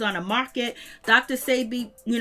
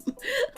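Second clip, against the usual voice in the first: high, soft giggle; laugh; 11 semitones higher, 8 dB quieter than usual. Real, not acted. Host amused by the Instagram talk, giggling. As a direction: let a high giggle slip out. Cracking up at comments.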